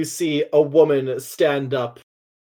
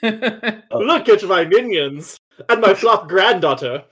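villainess showing photos; 6 semitones higher than usual. Acted.

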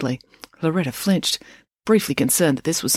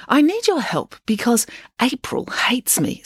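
Loretta; 8 semitones higher, slower than usual. Acted. Loretta pleads with Finn.

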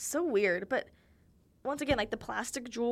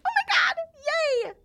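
much higher and louder squeal; squealing excitement; 10 dB louder, faster than usual. Retold or imitated. Girl hearing that Carson will ask her out, squealing. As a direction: shriek with delight.

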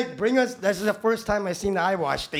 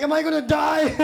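higher, louder frightened question; mock panic; 10 semitones higher, 6 dB louder than usual. Rookie panicking over wrinkled burned feet, loud mock. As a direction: ask it in comic alarm. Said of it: "I'm like".

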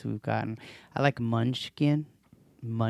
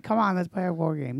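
coaxing plea; 6 semitones higher than usual. Retold or imitated. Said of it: "I'm like".

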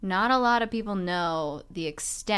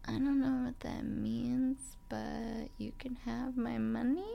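higher, soft tentative offer; timid bargaining; 6 semitones higher, 7 dB quieter than usual. Retold or imitated. Mugging victim offers the mugger money, timid and soft. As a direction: quote it softly, pitched up and unsure.

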